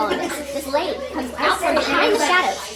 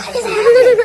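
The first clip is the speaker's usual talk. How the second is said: bewildered; 8 semitones higher, 12 dB louder than usual. Retold or imitated. Friends staring at each other.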